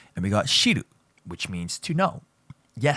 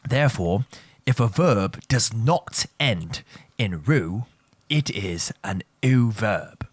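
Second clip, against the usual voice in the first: slow and deliberate, word by word; slow repeat; slower than usual. Shown as a demonstration. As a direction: spell the rule out slowly for the class. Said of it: "let me repeat that for you one more time".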